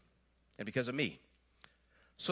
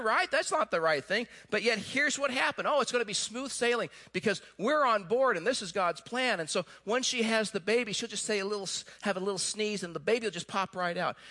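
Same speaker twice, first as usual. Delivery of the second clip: ordinary talk, nothing marked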